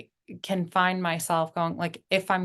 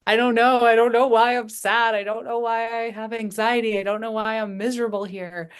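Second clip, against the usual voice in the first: put-on distressed child; 4 semitones higher, 3 dB louder than usual.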